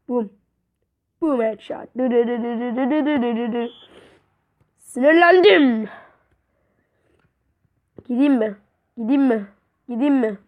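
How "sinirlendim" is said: mock annoyance.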